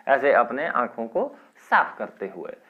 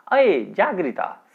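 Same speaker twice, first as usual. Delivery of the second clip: slow raised call to a daughter; mother calling; 6 semitones higher, 5 dB louder, slower than usual. Acted. Mother waking her daughter, a slow raised call. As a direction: call her awake, drawn out.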